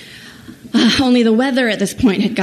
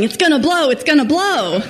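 raised, urgent repeated warning; mock yell; 7 semitones higher than usual. Husband yelling at wife as engine smokes, mock panic. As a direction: yell it like the engine's about to explode. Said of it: "Tab starts yelling".

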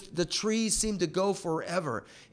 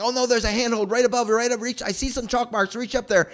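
excited, high-pitched overlapping calls from below; mock shouting, pitched up and urgent; shouted advice; 8 semitones higher, 3 dB louder than usual. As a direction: imitate friends shouting up advice, high and hurried. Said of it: "they start shouting all this advice".

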